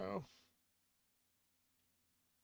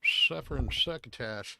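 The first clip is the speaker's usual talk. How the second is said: louder spraying lisp on the s sounds; a lisping cartoon cat, loud and spluttery; 3 semitones higher, 7 dB louder than usual. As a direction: ham up a sloppy cartoon lisp. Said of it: "Can you do the full stream with that accent?".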